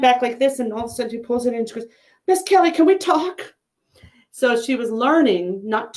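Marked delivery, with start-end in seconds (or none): 2.3-3.5 imitating a small girl, much higher; high little girl voice. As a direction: pipe it up like a three-year-old.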